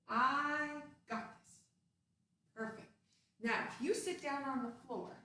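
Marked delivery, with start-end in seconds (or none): 0.1-1.6 loud, slow and proud; confident cheer. Shown as a demonstration. Coach teaching kids to say it, proud slow shout. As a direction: point and declare it with pride. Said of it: "point your finger".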